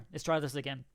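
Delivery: usual speaking voice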